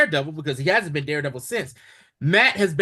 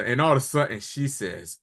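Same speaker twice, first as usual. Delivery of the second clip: normal talking voice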